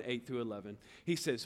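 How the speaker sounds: normal talking voice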